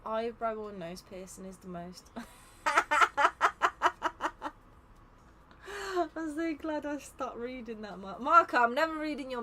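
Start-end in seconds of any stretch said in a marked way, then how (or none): 2.2-6.1 loud, high peals of laughter; long, loud real cackle; hard laugh. Real, not acted. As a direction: laugh helplessly at a message. Reads an unexpected chat message.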